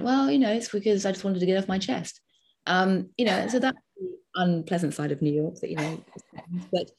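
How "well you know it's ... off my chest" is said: friend explaining. Retold or imitated. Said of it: "And she went".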